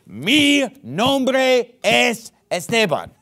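deliberate slow Spanish "Mi nombre es Esteban". Shown as a demonstration. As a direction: stretch out "Mi nombre es Esteban" word by word. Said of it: "I don't have to say".

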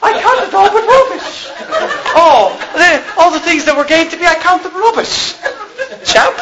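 high-pitched mock British voice; poking fun with a poor British impersonation; bad British accent. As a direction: put on a hammy, clumsy British accent. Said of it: "I'm not good at a British accent".

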